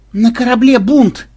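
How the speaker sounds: high, loud, drawn-out cry; shouted alarm. Acted. Officer realizes there is a mutiny aboard, shouting it. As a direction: cry out the dreadful news.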